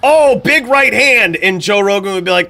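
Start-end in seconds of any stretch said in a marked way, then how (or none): 0.0-1.4 higher, louder, slow hyped call; mock Goldberg call. Streamer mocking an old commentator calling a punch, hammed-up. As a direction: ham up a clueless commentator's big call. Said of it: "he would say shit like".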